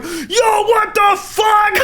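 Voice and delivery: high laughing shout of disbelief; retold shout. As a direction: yell at him like he's crazy.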